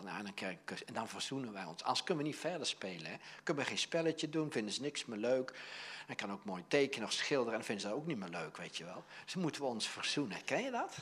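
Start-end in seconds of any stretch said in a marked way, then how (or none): none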